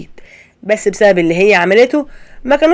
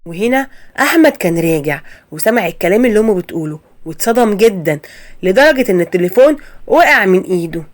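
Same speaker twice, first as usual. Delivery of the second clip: usual speaking voice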